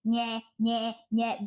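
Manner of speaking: taunting nasal nyeh sounds; childish mock whine; sing-song mocking nyeh. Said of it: "If you provided a space for me to be".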